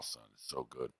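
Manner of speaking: ordinary delivery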